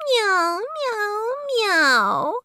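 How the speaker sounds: high sad kitten meows; crying meows. Acted. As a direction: mew pitifully like a kitten. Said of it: "began to cry".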